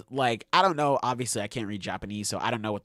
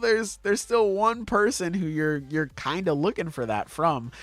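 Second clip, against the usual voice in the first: skeptical; 10 semitones higher than usual. Retold or imitated. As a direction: sound unconvinced and teasing. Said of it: "but I'm like".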